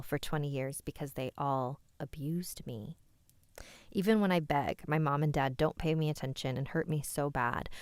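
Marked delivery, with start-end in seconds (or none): none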